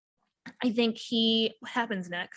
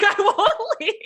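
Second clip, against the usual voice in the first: mock meek, laughing; 10 semitones higher, 5 dB louder than usual. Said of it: "Like, he's like".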